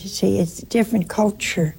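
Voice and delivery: ordinary talk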